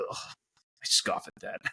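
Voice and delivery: slow, soft, voiceless groan of disdain; mock disgust, slow and under the breath; scoffing disdain. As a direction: imitate a friend scoffing, hushed and drawn out. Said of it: "he was like".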